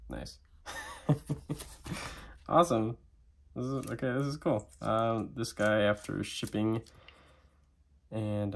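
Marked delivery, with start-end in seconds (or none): 0.7-2.4 quiet airy chuckle; little unvoiced laugh; soft breathy laugh. Real, not acted. Reacting to the new pen.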